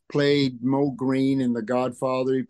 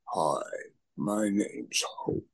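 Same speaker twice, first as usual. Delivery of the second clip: mock deep voice; 5 semitones lower, 10 dB quieter, slower than usual. Big gym girl voiced deep.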